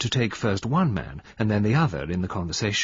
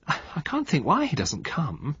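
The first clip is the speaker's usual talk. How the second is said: put-on childish bewilderment; 6 semitones higher than usual. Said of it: "a kind of childish bewilderment".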